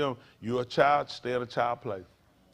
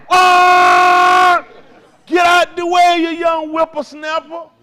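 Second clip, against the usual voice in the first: high, loud, slow geezer yell; cranky old man shouting; 14 semitones higher, 14 dB louder, much slower than usual. Acted. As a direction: holler like an old man running kids off the road. Angry old driver shouting.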